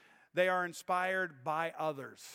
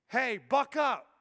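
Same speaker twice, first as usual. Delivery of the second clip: slow, louder, mock-brisk kid telling Dad to toughen up; daughter's pep talk; 7 semitones higher, 8 dB louder, slower than usual. Pastor voicing his nine-year-old daughter cheering him on, mock-brisk. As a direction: play the little girl bucking Dad up. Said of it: "this little nine-year-old girl sticks one to Dad".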